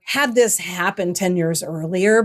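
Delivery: ordinary talk, nothing marked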